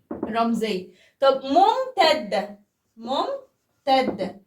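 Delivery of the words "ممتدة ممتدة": ممتدة pulled long, syllable by syllable; stretched-out word. Shown as a demonstration. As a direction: draw ممتدة out very slowly. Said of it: "احنا قلنا هتبقى طويلة". (كلمة ممتدة ممطوطة ببطء)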